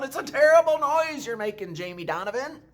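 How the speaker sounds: very high, very loud scolding shriek; mad old woman yelling. A narrator's reading. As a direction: shriek at the boy as if his noise hurts. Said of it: "she yelled".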